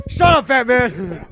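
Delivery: host shouting down his friend, slow and loud. Real, not acted. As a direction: Shut up, yelled slow and loud. Cuts off the friend's interruption.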